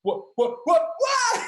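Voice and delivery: stammered what, climbing to a high yell; imitated stunned fan. Imitates the stunned fan's reaction.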